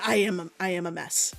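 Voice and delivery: normal talking voice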